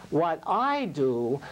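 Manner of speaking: usual speaking voice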